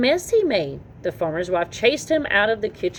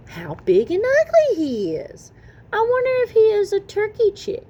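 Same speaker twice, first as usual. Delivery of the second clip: mother duck voice; 13 semitones higher, 3 dB louder than usual. Acted.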